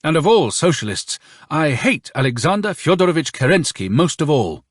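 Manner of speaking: high-pitched, emphatic confession; sudden blurt. A narrator's reading. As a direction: blurt it out with strong feeling. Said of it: "Turbin suddenly blurted out".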